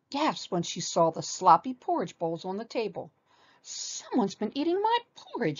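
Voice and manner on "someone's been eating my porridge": high, scandalised cry; shrieking. A narrator's reading. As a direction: squeal it in shock as mama bear. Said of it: "she shrieked".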